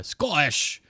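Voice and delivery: put-on playful failed Scottish accent; bad Scottish accent. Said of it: "That was bad too".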